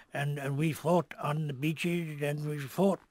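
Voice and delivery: slow, ponderous statesman impression; Winston Churchill. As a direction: draw it out like a wartime speech. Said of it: "Winston Churchill, please".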